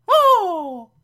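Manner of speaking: put-on a sudden high, loud falsetto old-lady "Oh!"; Aunt Harriet's gasp. Podcaster voicing Aunt Harriet's surprise, high shrill gasp. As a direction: squeal it like a startled old aunt.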